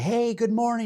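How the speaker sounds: a folksy Southern good morning; mock Southern greeting, high and bright; Southern accent. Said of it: "He's got the Southern accent".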